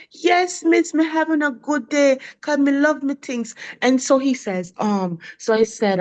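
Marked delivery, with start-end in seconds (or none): 0.1-3.6 put-on higher, eager childlike reply; lightly voiced second grader; little boy voice. Teacher retelling the boy's happy answer. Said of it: "He said".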